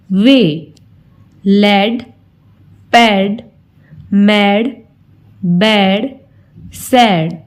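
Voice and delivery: each word said alone with long pauses between; model word reading. Shown as a demonstration. Teacher reading words aloud for the class to repeat, slow and clear. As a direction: read the word list slowly for pupils to repeat. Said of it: "आइए words को पढ़ना और repeat करना शुरू".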